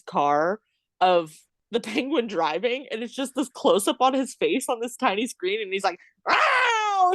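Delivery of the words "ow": high-pitched mock scream; imitated scream. Imitating the Penguin driving on a screen, high mock scream. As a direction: yelp like the Penguin on the tiny screen. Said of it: "Just screaming".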